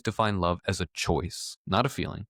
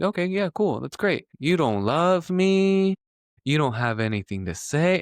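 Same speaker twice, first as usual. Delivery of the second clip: mock hurt mom; 7 semitones higher than usual. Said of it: "It's like".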